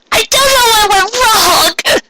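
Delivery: high, slow, tearful wail; crying. A narrator's reading. A distraught mare cries to her friends, high and tearful. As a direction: break down sobbing in a high voice. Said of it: "she cried".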